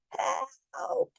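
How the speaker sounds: high, breathy cry for help; panicked yelp, pitched high; frightened cry. A narrator's reading. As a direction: reporter breaks off and cries out. Reporter cries out.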